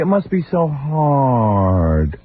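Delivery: very slow syrupy pity; mock sister again. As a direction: drag out the fake sympathy.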